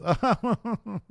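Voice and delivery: a high, uneasy little laugh; nervous laugh. Retold or imitated. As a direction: laugh awkwardly, covering nerves. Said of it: "unnerved laughs and says".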